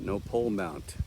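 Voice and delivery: ordinary talk, nothing marked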